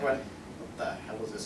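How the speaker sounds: usual speaking voice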